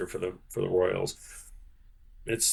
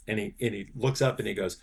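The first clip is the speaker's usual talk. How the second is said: normal talking voice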